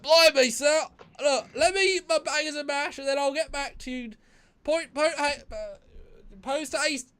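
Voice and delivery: very high Cockney caricature, shouted at first, then easing; Cockney English accent. Pretend. Accused Brit pleading in a mock court, hammed-up high Cockney accent. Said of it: "See, that's an English accent".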